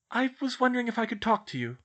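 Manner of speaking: high, mildly nervous request. A narrator's reading. Schoolgirl asks her professor for a talk, nervously. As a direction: ask tentatively in a lifted girl's voice. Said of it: "Hermione said nervously".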